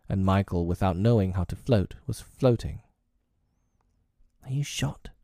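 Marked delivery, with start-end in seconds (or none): 4.4-5.1 hushed, unvoiced-sounding frightened whisper; tremulous whisper. A narrator's reading. John checks on Michael in the dark, tremulous whisper. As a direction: whisper it as if afraid to hear the answer. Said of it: "John whispered trem-, tremulously".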